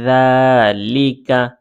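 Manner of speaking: যালিকা slow but in one joined breath; connected recitation of যালিকা; letters read together. Shown as a demonstration. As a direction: blend যালিকা without breaks, vowels held. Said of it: "অথবা একসাথেও পড়তে পারেন".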